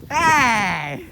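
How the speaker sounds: loud playful. Real, not acted.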